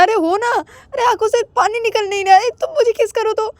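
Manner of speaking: high stammering mock sobbing; hammed-up fake crying. Ananya pretending to cry for a kiss, hammed-up. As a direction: fake the tears, badly. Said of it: "तुम नाटक कर रहे हो".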